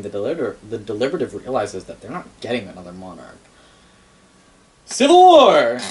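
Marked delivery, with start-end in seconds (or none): 4.9-5.8 loud, high proclamation; dramatic announcement. Acted. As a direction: announce it like a trailer voice. Dramatic announcement in lore story.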